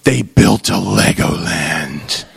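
mock-tragic low announcement; mock grave voice. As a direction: drop it low like bad news. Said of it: "And she says".

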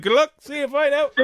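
high, airy goodbye; breezy brush-off. Retold or imitated. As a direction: toss it off lightly, up high. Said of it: "Ken threw you in front of him and was like".

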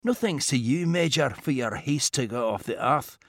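quiet, dry and precise; a composed inventor gently scolding; calm Scottish gentleman. Acted. Mackenzie character dialogue.

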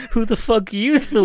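high, loud tearful outburst, half laughing; mock holding back tears. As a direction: play a man choking up as he lashes out. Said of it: "He's, like, holding back tears".

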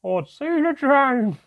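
high, loud, drawn-out jeer; mocking dwarf hoot; laughing dwarf. A narrator's reading. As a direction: scoff loudly in a high dwarf voice. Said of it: "laughed one of the other two dwarfs".

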